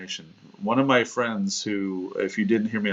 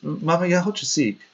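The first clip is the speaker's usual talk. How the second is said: put-on little boy asking; 8 semitones higher than usual. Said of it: "and he goes".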